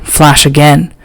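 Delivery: usual speaking voice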